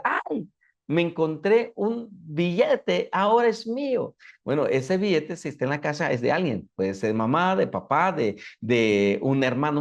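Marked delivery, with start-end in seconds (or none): 0.0-4.1 put-on higher-pitched, delighted little girl; niña emocionada. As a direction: play a girl claiming her lucky find. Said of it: "sale la niña, de la casa y ve un billete y dice". (niña emocionada con su hallazgo)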